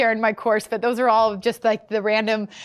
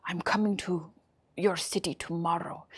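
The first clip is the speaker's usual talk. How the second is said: low, soft Russian spy voice; hushed mock Russian accent; 5 semitones lower, 9 dB quieter than usual. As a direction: quietly imitate an ominous Russian caller. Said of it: "this thick, like, Russian accent".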